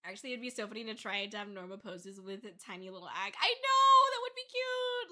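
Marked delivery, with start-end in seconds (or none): 3.4-5.1 high-pitched burst of delight; excited squeal. Real, not acted. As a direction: squeal at a sudden cute idea. Excited by a cute pose idea.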